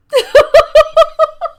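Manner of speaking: a very high, loud burst of laughing; loud laughter. Real, not acted. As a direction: break up completely. Cracking up at the text.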